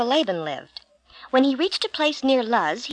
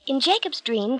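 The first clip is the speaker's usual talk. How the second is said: plain reading voice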